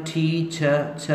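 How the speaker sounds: "ठी छ" with puffed consonants, open ending; aspirated t and ch. Shown as a demonstration. Teacher building the word for students, aspirated syllable by syllable. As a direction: aspirate both sounds in "ठी छ", no r. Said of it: "टे है इसको ठे कहें".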